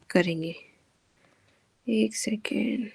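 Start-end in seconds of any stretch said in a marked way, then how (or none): 1.9-2.9 whispered aside. Real, not acted. Teacher whispering to herself while setting up. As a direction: drop to a whisper, just for yourself.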